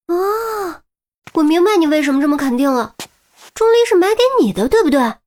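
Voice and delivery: high, rushed accusing questions; sudden realization. A narrator's reading. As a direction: pounce on the answer, fast and high.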